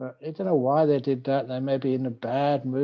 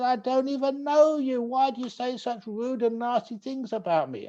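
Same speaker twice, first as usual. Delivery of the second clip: high, pained protest from the insulted husband; hurt husband; 11 semitones higher than usual. Acted. Husband protests to the young man, high and hurt. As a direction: plead to be left alone. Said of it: "He said".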